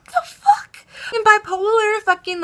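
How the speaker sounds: very high squealed disbelief; incredulous squeak. Acted. Storyteller baffled by the driver's mood switch, high incredulous squeak. Reacting to his sudden change of manner.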